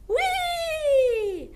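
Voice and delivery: very high squeaky hee; goblin squeal. Shown as a demonstration. Teacher shows the class a goblin's squeal. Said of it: "Goblins dance".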